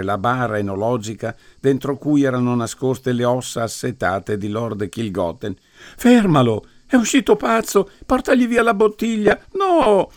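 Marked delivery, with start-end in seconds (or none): 5.9-10.1 much higher, louder outraged yelling; indignant fury. A narrator's reading. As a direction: scream to stop him. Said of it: "una selvaggia esplosione di furore indignato".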